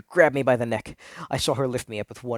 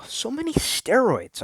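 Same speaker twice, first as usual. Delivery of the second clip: high, slow, incredulous; mock disbelief; 12 semitones higher, slower than usual. Narrator recalling his thought about bodybuilders, high and drawn out. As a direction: stretch it out high in shocked disbelief. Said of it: "I thought".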